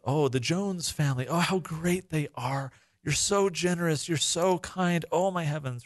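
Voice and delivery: hammed-up flattering high voice; mock gushing praise. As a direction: gush over the donors like a sycophant. Mocking imagined praise of donors.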